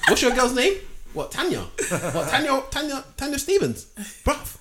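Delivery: high taunting voice; mocking taunt. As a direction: tease like the rival would.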